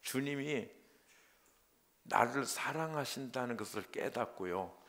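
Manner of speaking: ordinary talk, nothing marked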